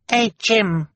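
put-on very high little child's voice; baby talk. A narrator's reading. Baby Sunny answers about her present, high babble. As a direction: squeak it out like a toddler.

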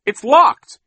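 very loud, high exclamation; alarmed cry. A narrator's reading. Mister Poe finds the gate locked, crying out. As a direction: cry out the bad discovery. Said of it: "he cried to the children".

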